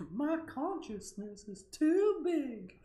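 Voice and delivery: very high, slow, drawn-out squeal; loud, piercing parody quote; silly cartoon voice. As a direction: wail it slowly in an absurd cartoon voice.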